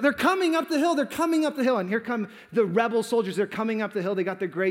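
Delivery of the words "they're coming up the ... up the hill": high, loud shouted warning; shouting out. Retold or imitated. Boy warns the troops of the attack, shouting out. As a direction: shout it like a lookout raising the alarm. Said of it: "the boy shouts out".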